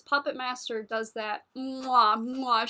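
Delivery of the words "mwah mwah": two loud smacking kiss sounds; chef's kiss. Shown as a demonstration. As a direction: kiss the fingertips like a chef. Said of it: "chef's kiss".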